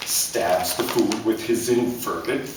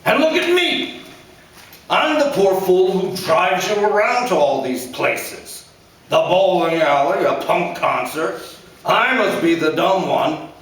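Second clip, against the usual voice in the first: a blustering father scolding his son; angry father; 9 semitones higher, 5 dB louder than usual. A narrator's reading. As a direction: lecture him like a bitter dad. Father self-pitying rant.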